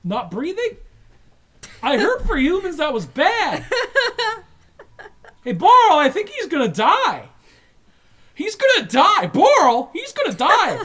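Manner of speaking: high, loud, frantic; he's gonna die, panic; panicked alien. Acted. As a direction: alien guard shouting in laughing panic. Guards panic, human dying.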